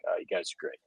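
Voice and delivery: whispered mock warmth from the taxi driver; cabbie's hushed approval. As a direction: breathe it out as a friendly aside. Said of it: "he's like".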